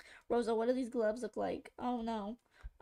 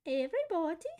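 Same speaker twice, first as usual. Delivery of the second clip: posh British woman; 4 semitones higher, 3 dB louder than usual. Retold or imitated. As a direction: stretch it out like a prim British lady. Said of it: "Who said that?".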